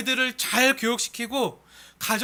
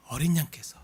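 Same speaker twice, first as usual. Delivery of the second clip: ordinary talk, nothing marked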